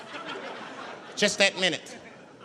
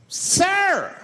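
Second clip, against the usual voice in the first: high, loud mock outburst; outraged passenger; 13 semitones higher, 5 dB louder than usual. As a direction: cry out as a shocked passenger. Freaked-out passenger objects to smoking.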